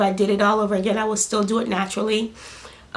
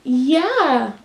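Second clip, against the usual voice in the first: higher, slow cooing; 4 semitones higher, 4 dB louder, slower than usual. Real, not acted. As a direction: mom soothing her newborn. Soothing her baby.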